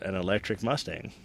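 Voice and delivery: ordinary talk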